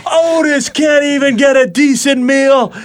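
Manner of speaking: high shouted heel promo; wrestling heel promo. Retold or imitated. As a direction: yell it at the booing crowd. Quoting a wrestling heel's promo.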